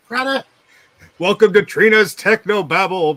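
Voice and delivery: big booming announcer call; fighting game announcer. Pretend. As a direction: hype it like a ring announcer. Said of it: "Do you want me to do an announcer voice?".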